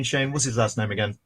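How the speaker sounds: normal talking voice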